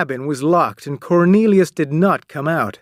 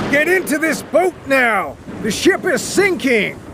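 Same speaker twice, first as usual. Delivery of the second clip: captain shouting; 11 semitones higher than usual. Acted. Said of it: "shouted the captain".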